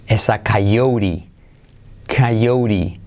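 coyote drawn out as "kai-OU-ri"; low, slow coyote with a tapped r; slow word drill. Shown as a demonstration. Teacher drilling the word, coyote slowly as "kai-OU-ri".

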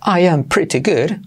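careful English pretty good, crisp t; British clear-t delivery; British accent. Shown as a demonstration. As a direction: say it as in England, full I am. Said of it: "igual en Inglaterra dirían".

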